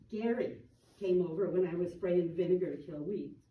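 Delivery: usual speaking voice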